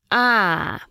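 long drawn-out "ah" of understanding. A narrator's reading. Old man content with the answer he got, drawing it out. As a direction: stretch it out like a satisfied old man. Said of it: "протянул старик".

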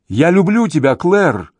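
high, pleading call; shouted declaration. A narrator's reading. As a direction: call it up to someone out of reach. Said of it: "кричит он ей".

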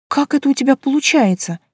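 ordinary delivery

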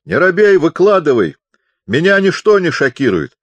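high, loud and hearty; loud urging. A narrator's reading. Deaf chaplain urges the shy visitor to speak, loudly. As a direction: urge him on at the top of your voice.

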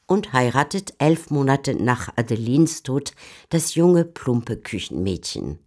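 usual speaking voice

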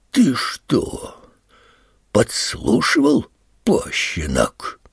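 slow, drawn-out threat, half whispered at first; menacing accusation. A narrator's reading. Stepfather catches boy eavesdropping, threatens. (угрожающий допрос, медленно, с растяжкой)

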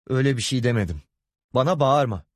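yükselen perdeden sert uyarı; eşine sesini yükselterek çıkış; bağırarak çıkışma. Acted. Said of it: "Bağırma vallahi". (hafif bağırarak savunma)

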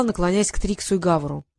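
usual speaking voice

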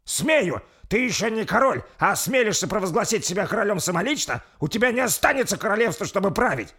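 loud, high angry challenge; defiant retort. Acted. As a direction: throw it back in his face. Лорд бросает вызов королю. (дерзко, с гневным вызовом)

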